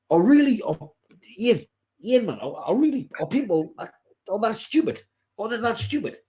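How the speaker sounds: high, disbelieving outcry at stupid people; incredulous. Real, not acted. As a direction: rise up in disbelief, are people really that stupid. Exasperated at stupid customers.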